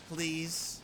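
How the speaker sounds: ordinary talk, nothing marked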